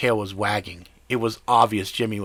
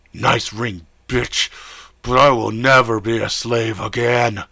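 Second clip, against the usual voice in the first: louder, defiant growl; 6 dB louder than usual. Acted. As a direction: spit the refusal back. Werewolf defies his master.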